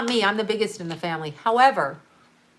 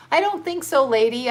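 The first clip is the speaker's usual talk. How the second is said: sassy; 5 semitones higher, 3 dB louder than usual. Real, not acted. Sassy rebuke of Oprah.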